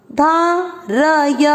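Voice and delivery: धारय said slowly as धा-र-य with full vowels. Shown as a demonstration. Teacher spelling out धारय for the class. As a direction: sound every syllable slowly. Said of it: "र और य ... अ का उच्चारण".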